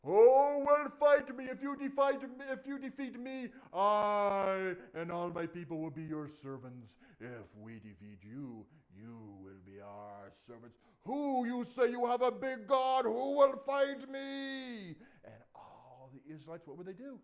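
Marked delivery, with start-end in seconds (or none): 0.0-6.9 huge, high, booming challenge; loud, drawn-out giant's roar; giant calling up. Acted. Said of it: "he'd start calling up". 7.2-10.8 low, slow, ponderous giant; deep, measured threat; giant's challenge. Acted. Giant continues his challenge, lower. 11.1-14.9 high, booming, drawn-out challenge; huge giant's shouted dare; giant calling up. Acted. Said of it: "he'd start calling up".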